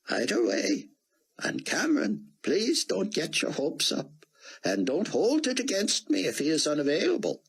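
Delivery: put-on high, foreign-sounding older gentleman; old Israeli scholar. Rosenzweig voiced by the narrator.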